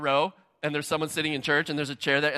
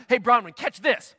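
loud, higher, sharp call as if firing a fastball; hard throw call; 5 semitones higher, 5 dB louder than usual. Pretend. Pastor pretending to fire the ball at pregnant Bronwyn, loud. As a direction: bark it like you're about to rifle the ball. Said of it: "I didn't do that".

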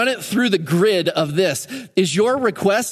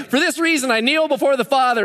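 high, breezy, offhand reading; Paul tossing off his prayer, high and careless; mock casual Paul; 8 semitones higher than usual. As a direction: toss it off like it means nothing. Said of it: "Can you imagine if Paul's, like, just writing".

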